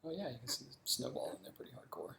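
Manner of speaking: hushed unvoiced aside; whispering. Real, not acted. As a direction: whisper it under your breath. Quiet aside while fixing the camera.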